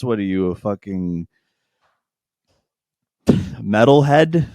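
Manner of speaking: slow, drawn-out insult; mock roast. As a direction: stretch the jab out slowly to mock him.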